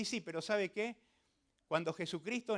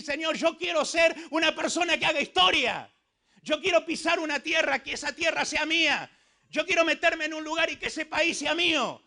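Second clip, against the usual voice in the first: raised, loud declaration to God; fervent cry; 7 semitones higher, 7 dB louder than usual. Acted. Preacher voices young people pledging to God, fervent cry. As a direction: call it out high and loud, phrase by phrase.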